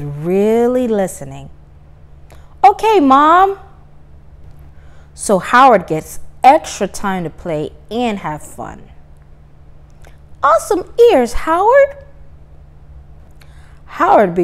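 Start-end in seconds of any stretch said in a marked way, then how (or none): 2.6-3.6 put-on much higher and louder boy voice; eager child agreeing; cheerful kid Howard. Howard answers his mother. 10.4-12.0 much higher and louder cheer; excited praise. Acted. Praise for Howard.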